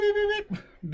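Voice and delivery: high squeaky microwave beeps; imitated beeps.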